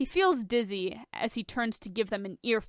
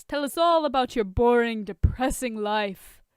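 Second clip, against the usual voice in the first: mocking; 3 semitones higher, 4 dB louder than usual. Tony mocking Bruce.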